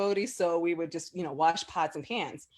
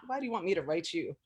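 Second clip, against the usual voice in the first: soft, baffled question after a sigh; half to herself, not getting the point; quiet puzzlement; 9 dB quieter, faster than usual. Retold or imitated. Puzzled reply to the guru telling her to write, said softly.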